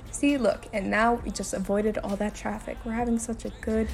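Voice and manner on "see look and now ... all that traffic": normal talking voice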